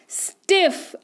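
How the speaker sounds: "स्टिफ" said alone in one push; one syllable. Shown as a demonstration. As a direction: say "स्टिफ" as a single isolated syllable. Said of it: "मुंह से एक ही बार निकल रहा है".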